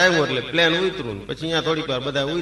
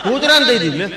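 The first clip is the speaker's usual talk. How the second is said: laughing outburst; 8 semitones higher, 6 dB louder than usual. Real, not acted. Punchline, audience laughing.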